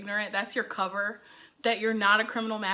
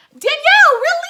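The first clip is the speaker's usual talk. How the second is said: loud, high-pitched shout; high, blaring outburst of reproach; incredulous scolding; 15 dB louder than usual. Acted. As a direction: yell it in shrieking disbelief. Scolds the character in disbelief.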